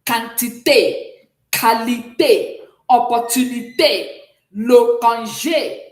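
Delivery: "Quantité, qualité, opportunité, le congé" loud and slow, each ending on a sharp é; sharp accented é. Shown as a demonstration. Teacher shows the role of acute accent, sharp é endings. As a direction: drill each French word loudly, stretching the final é. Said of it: "acute accent plays in providing pronunciation direction".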